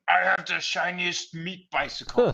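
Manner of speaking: high-pitched crazed raider yell. Acted. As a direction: yell it like a raving raider running around. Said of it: "just running around yelling".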